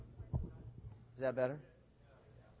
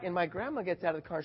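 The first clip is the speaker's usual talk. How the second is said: ordinary talk, nothing marked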